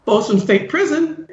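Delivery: high, slow, laughing prison greeting; fake prison answering. Answering the scammer's callback as a mock prison operator. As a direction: pick up like a prison switchboard. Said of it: "I just answered and said".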